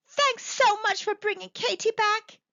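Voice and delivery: high, bright grateful thanks; Lillian's voice. Acted. Lillian thanking the old lady at the door, high and grateful.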